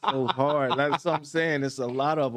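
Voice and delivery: laughing. Real, not acted. Hard choice, laughing it off.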